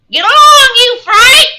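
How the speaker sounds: full-force, very high squawk; screaming parrot. A narrator's reading. Parrot screams at the girl left behind, piercing squawk. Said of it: "screamed Polly".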